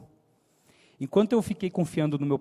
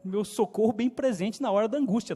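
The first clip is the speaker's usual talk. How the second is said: ordinary talk, nothing marked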